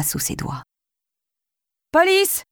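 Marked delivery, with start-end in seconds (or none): 1.9-2.4 much higher calling shout. Acted. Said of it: "cria-t-elle". (cri aigu dans l'escalier)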